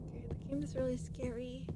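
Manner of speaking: unvoiced scared whisper. Real, not acted. Streamer whispers to chat that the game is scary. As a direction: whisper it so the game won't hear.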